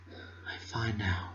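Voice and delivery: soft, slow, near-whisper; barely above a whisper. Acted. Fluttershy softly reassuring the narrator, barely audible. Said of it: "barely above a whisper".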